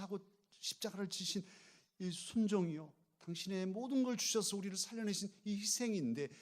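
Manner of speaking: high, loud, building sermon climax; fervent pulpit preaching; preaching at full force. Acted. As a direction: drive the point home with fervor.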